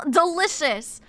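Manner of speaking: ordinary talk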